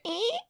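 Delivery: high squeaky stair creak; creak noise. Retold or imitated. Imitating the stair creak, squeaky high.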